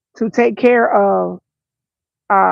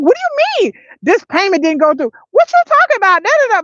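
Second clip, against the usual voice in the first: angry on the phone; 10 semitones higher, faster than usual. Retold or imitated. Mother angry at customer service on the phone. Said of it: "they made me mad".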